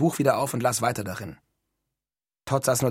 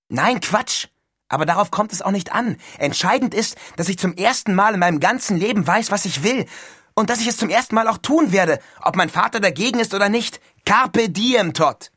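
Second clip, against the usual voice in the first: high, loud, rousing schoolboy fervour; elated Neil; 8 semitones higher, 5 dB louder than usual. A narrator's reading. Neil declares his resolve to his roommate, elated. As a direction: proclaim your new resolve jubilantly.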